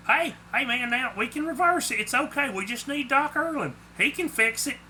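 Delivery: high, anxious reassurance; nervous placating. A narrator's reading. Guilty Clark placates an angry Sasquatch.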